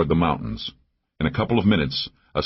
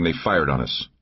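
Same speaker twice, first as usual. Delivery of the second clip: usual speaking voice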